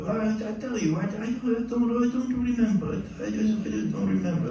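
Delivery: high stammering imitation; flustered denial. Composer defends himself to singer, flustered high imitation. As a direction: fumble the excuse, pitched well up.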